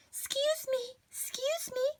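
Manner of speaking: fretful little plea for attention; timid high voice; high, soft anxious lady. Acted. Mrs Prim searching.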